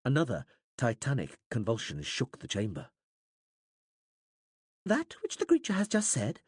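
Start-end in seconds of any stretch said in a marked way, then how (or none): 4.8-6.4 high, puzzled alien interpreter voice; a puzzled query from an alien interpreter. A narrator's reading. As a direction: voice the creature, higher and puzzled. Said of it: "sounding puzzled".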